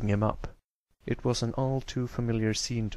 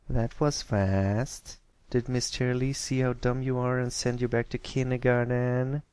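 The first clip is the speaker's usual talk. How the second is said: mocking schoolgirl bully; 2 semitones higher than usual.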